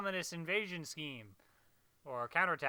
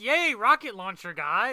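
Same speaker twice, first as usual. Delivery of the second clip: cheering; 5 semitones higher, 10 dB louder, slower than usual. Retold or imitated. Cheering a hero with friend.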